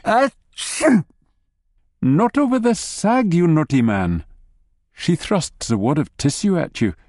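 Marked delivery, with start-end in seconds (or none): 0.1-1.1 big convulsive achoo; sneeze. A narrator's reading. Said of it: "convulsive sneezing fit takes hold". 2.0-4.2 high, lightly scolding wife; playful telling-off. A narrator's reading.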